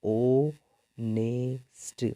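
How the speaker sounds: ऑनेस्ट said very slowly, starting on a clear o sound with a silent h; teacher stretching the word ऑनेस्ट so students hear the o; spelled-out pronunciation. Shown as a demonstration. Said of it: "इसको हम कैसे पढ़ रहे हैं?".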